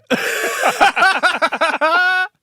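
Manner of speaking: loud high laugh after the joke; big high laugh. Real, not acted. Host finishing the joke, a loud high laugh. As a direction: burst out laughing.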